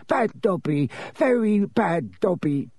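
high-voiced elf scolding himself; slow, self-punishing high mutter. A narrator's reading. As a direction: scold yourself in a little elf voice.

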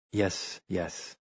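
put-on soft, gentle old man soothing; calm a drunk young man gently; kindly. A narrator's reading. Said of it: "Orsini said kindly".

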